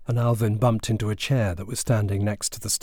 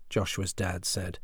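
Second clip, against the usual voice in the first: plain reading voice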